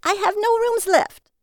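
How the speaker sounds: loud, very high-pitched scream. A narrator's reading. Woman fighting to shut her door, screaming. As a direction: shriek it to get rid of them. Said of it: "she screamed and slammed the door".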